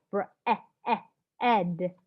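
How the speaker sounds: "Bre-, eh, eh, ed" split into sounds; teacher sounding out the word. Shown as a demonstration. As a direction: break "Bread" into its sounds.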